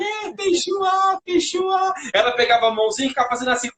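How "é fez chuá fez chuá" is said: high, slow delighted chuá; excited whoosh. Retold or imitated. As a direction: make chuá sound like a joyful whoosh. Excited sound effect.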